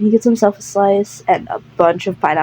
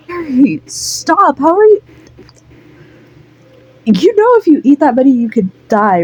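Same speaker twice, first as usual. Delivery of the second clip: exasperated scolding; 6 semitones higher than usual. Acted. Friend scolds Larry for overeating.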